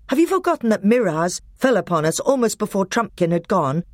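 put-on young king defending himself. A narrator's reading. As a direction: argue your case hotly as the king. Caspian voiced in story.

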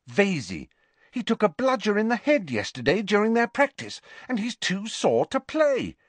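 high-pitched, gleeful news-breaking; excited. Acted. Ginny tells the team rivals are out, excited.

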